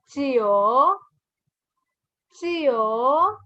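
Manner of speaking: "chio" as "jee-oh", gliding upward; ch sound. Shown as a demonstration. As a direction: stretch "chio" with a rising open oh. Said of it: "makes the sound ch".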